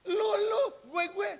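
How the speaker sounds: high-pitched mock brat talking back; imitating rude child. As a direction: imitate a disrespectful child addressing a parent. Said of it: "anak ngomong sama orang tuanya". (menirukan anak kurang ajar, nada tinggi)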